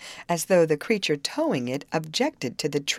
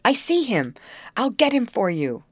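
louder, higher hurried reply; quick nervous call; 5 semitones higher, 4 dB louder than usual. A narrator's reading. Youth reassuring the girls from the scow, nervous call. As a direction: keep calling quickly, a bit jittery. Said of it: "quick, nervous manner".

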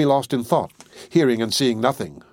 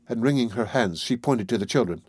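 plain reading voice

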